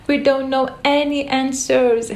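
high, gurgly Hatter. Acted. Hatter answering Alice, high gurgle. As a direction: giggle it out. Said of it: "he gurgled".

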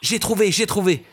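cri joyeux, beaucoup plus aigu; crie soudain. Acted. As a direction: lancez l'idée d'un cri ravi. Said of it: "crie soudain un". (l'oiseau annonce son idée, cri joyeux)

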